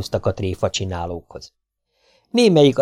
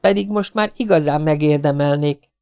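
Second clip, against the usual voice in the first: put-on sulky boy; 7 semitones higher than usual. Domi sulks.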